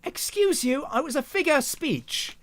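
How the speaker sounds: very high, indignant Australian attempt; attempted Australian accent. Velvet correcting her partner after blushing, high attempted Australian accent. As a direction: squeal it in a bad Australian accent. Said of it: "work on my Australian accent".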